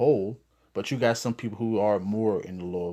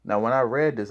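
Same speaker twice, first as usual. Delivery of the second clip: ordinary delivery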